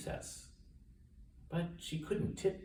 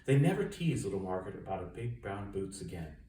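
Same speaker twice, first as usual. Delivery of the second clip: ordinary delivery, nothing marked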